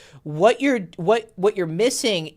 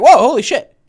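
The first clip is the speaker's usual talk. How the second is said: high, loud whoa; loud excited exclaim; 8 semitones higher, 10 dB louder than usual. Shown as a demonstration. Demo of being loud and expressive, big shout. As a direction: shout it out like a shocked friend. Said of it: "really loud and expressive".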